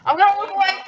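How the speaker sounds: high, loud panicked cry. Real, not acted. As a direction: squeal it out in fright. Scared of coming jump scare.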